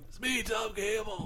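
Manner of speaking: put-on quiet, frail old man voice; a hint of a creaky old man; Tom Gamble old man voice. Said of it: "this very old man voice of".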